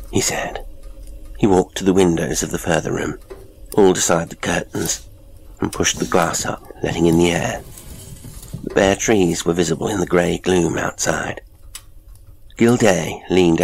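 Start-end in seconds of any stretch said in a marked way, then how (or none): none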